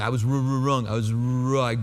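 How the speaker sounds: lightly voiced cool guy who can't say wrong; a hint of the Fonz choking on the word wrong; Fonzie. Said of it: "I'm like Fonzie".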